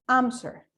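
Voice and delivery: "Answer" with the first syllable AN strongest, second falling away; stress on AN. Shown as a demonstration. Teacher asking where the voice is strongest, stress on AN of "Answer". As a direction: punch AN in "Answer" and let the rest drop. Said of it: "En la primera".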